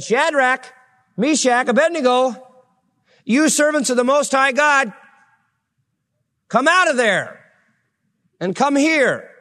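high, summoning the three from the fire; king calling out. Acted. King calls men from furnace.

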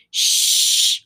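drawn-out "shh" from behind a door; voiceless, forceful shush; long shushing hiss. A narrator's reading. Someone behind the door shushing the children, long hiss. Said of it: "said the door".

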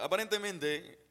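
put-on newscaster delivery; news anchor. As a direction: announce it like a TV anchor. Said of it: "podemos hacer el hombre ancla".

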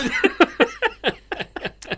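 words spilling out through laughter; high, quick and giggling; laughing. Real, not acted. Laughing while teasing a friend.

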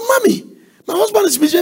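high pleading daughter voice; whiny daughter. Retold or imitated. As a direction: squeak it up like a grown daughter begging.

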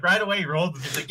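usual speaking voice

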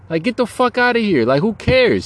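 high-pitched, incredulous brush-off; indignant dismissal. Real, not acted. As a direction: scoff at it with pitch shooting up. Dismissing the outrage over cocaine.

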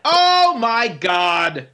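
high, very slow exaggerated groan; drawn-out mock dismay. Told to download the Cracker Jack app, drawn-out mock dismay. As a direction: wail it long and high in disbelief. Exasperated reaction, repeated.

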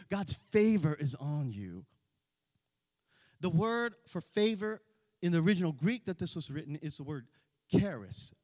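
normal talking voice

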